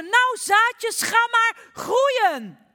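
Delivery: full high shout, very slow; all-out stretched scream at the seeds. Acted. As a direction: yell it at the top of your lungs. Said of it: "en schreeuwde".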